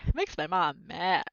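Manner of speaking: ordinary delivery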